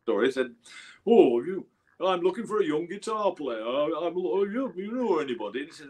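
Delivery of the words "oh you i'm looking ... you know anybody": higher-pitched Yorkshire voice; Yorkshire accent. Retold or imitated. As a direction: put on a Yorkshire accent for the bandleader. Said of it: "do the accent".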